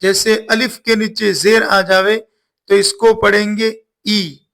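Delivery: ordinary delivery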